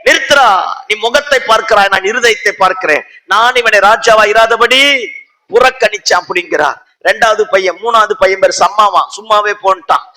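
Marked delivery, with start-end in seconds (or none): 0.0-6.2 loud, high-pitched commanding proclamation; high, forceful preacher's rebuke; stern divine rebuke. Acted. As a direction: thunder it out as God halting someone. Acting out God's stern rebuke.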